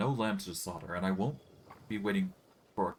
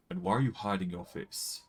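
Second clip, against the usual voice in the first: ordinary delivery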